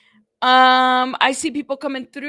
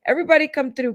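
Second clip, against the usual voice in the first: usual speaking voice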